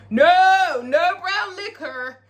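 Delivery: loud, high, drawn-out mock horror; mock outraged refusal. Mocking Roulette turning down brown liquor, hammed-up outrage. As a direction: refuse it slowly and loudly, hammed-up.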